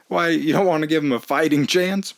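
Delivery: ordinary talk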